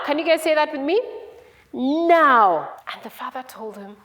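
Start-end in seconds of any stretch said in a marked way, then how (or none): 1.7-2.7 long, loud, insistent word; insistent storybook demand. Acted. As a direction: draw it out for kids to chant. Repeats demand with the children.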